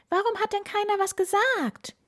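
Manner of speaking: alarmed. A narrator's reading. Lenny realising a storm is coming, high alarmed squeak. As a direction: squeak it out in disbelief.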